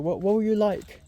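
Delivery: quick British bartender's question. Retold or imitated. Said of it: "this British guy".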